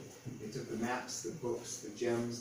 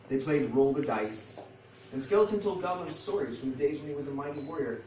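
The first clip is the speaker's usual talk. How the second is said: ordinary delivery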